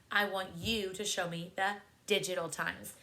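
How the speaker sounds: ordinary delivery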